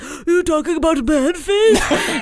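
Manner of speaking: high, slow eager cat voice; Manface voice. Retold or imitated. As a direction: talk like a happy third-person cat. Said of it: "his voice is like".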